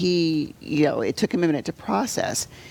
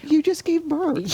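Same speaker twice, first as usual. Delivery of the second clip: much higher, disbelieving exclamation; incredulous; 12 semitones higher than usual. Retold or imitated. Mother thinking about her daughter-in-law, incredulous. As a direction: say it like you can't believe she'd offer.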